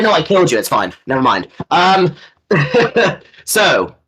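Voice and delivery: ordinary talk, nothing marked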